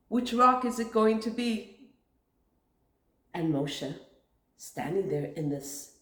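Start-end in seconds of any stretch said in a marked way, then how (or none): 0.0-1.9 lightly voiced taunting crowd with a laugh; mocking. The people mocking Moshe, lightly taunting. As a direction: tease with a chuckle. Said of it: "and mocking".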